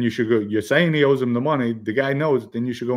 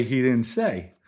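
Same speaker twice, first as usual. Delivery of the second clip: ordinary talk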